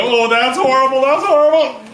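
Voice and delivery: high, loud hammed-up disgust; mock disgust. Eater reaching for the frog, loud mock disgust. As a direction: squeal at the horrible frog. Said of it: "you reach down and you go".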